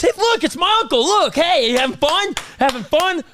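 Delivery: high-pitched mock kid excitement; young son. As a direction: imitate a child showing off to little friends. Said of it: "my son just goes all to all his little friends".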